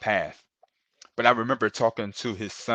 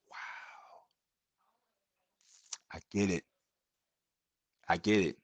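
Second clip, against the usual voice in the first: awed whisper; 2 semitones lower, 6 dB quieter than usual. Retold or imitated. Sudden realization of fatherhood.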